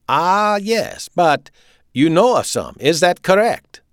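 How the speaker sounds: put-on high, loud German-accented questioning. A narrator's reading. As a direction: press the question, pitch climbing. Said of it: "Wirz's voice was getting higher".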